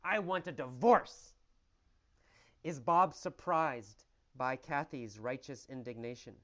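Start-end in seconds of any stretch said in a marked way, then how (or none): 0.0-1.3 furious yell, higher and louder; angry yell. Retold or imitated. Wife yelling at her husband to demand divorce, furious shout. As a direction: scream at her husband in outrage. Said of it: "she says".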